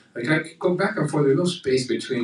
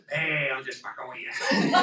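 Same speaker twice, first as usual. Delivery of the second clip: heart's teasing voice; 3 dB quieter than usual. Retold or imitated. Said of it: "I hear my heart go".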